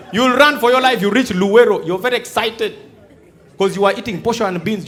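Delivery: ordinary talk, nothing marked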